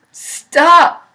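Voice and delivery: terrified pig screaming; screamed. A narrator's reading. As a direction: scream it to make it stop. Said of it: "screamed Wilbur".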